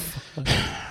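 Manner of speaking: soft voiceless dog huff; imitated dog sigh. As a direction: puff out softly like a dog letting go. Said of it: "he, like, let out that".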